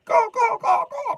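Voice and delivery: very high, loud crow cawing; crow caws. Acted. Crows cawing overhead, very high and loud. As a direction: screech like crows.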